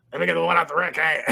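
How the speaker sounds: comic voice for a talking dog. Retold or imitated. Dog telling its owner what food it wants, talking dog. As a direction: put on a dog character's voice. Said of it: "the dog's like".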